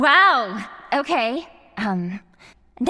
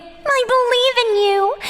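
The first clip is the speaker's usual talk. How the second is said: sarcastic singsong encouragement, higher and louder; mock cheer; 10 semitones higher, 4 dB louder than usual. Captor mockingly cheering her captive on, sugary mock cheer. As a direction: cheer him on with fake sweetness.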